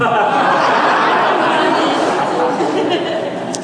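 long high airy laugh; a real laugh, breath only. Real, not acted. Guest laughs while hinting at a Hawaii invitation, airy.

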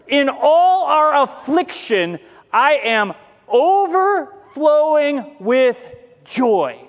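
slow, stretched reading of the verse; slow scripture reading. Acted. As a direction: draw out every word of the scripture.

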